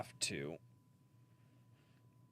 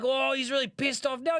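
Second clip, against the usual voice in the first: higher, louder excited Australian khaki-shirt showman; hammed-up Australian wildlife host, higher pitched; Steve Irwin impression; 5 dB louder than usual. Streamer retells an Australian comic's Steve Irwin bit, higher, hammed-up Australian. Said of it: "Steve Irwin bit".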